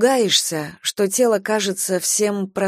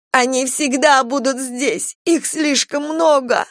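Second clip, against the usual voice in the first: wild unhinged ranting; 6 semitones higher, slower than usual. A narrator's reading. Marjorie keeps ranting.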